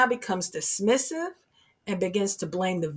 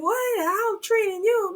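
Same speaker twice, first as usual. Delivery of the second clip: indignant victim, pitched up; 11 semitones higher than usual. Retold or imitated. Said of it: "you're like".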